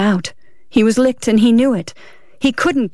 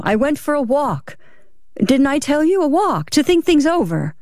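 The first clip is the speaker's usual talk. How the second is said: higher, insistent protest; defensive; 7 semitones higher than usual. Acted. Husband defending his story to wife, defensive. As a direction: insist on the alibi in a raised pitch.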